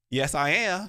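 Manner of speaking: high, twangy Southern drawl imitation; Southern accent. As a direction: imitate a young Southern woman's thick accent. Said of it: "thick ass accent".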